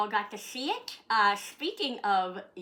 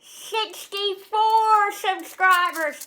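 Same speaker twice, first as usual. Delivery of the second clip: proud fanfare; 11 semitones higher, 11 dB louder, much slower than usual. Real, not acted. Announcing subscriber count.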